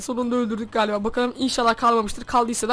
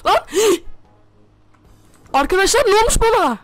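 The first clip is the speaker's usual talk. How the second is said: panicked; 7 semitones higher, 3 dB louder, slower than usual. Real, not acted. Saat takılı kaldı, panik.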